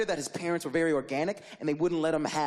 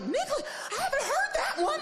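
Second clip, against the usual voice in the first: much higher, baffled squeak; stunned disbelief. Retold or imitated. Babysitter hearing a new word, high and stunned. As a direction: ask it in shocked confusion. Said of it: "I was like".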